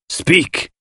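high, sharp command; menacing cry. A narrator's reading. Poirot stands over the silent suspect, menacingly. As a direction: demand an answer with menace. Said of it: "he cried menacingly".

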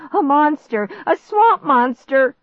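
high, loud cry of alarm; panicked warning. A narrator's reading. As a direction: shout the alarm, stretching it out. Clark screams warning of monster.

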